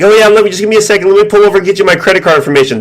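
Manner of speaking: high, fast fake-eager voice; mock cooperative victim. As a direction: gush along pretending to comply. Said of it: "they're like".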